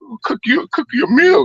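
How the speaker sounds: high, sing-song imitation of a hostess; lightly voiced woman inviting you in; mock Southern hospitality; welcoming southern woman. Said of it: "they like".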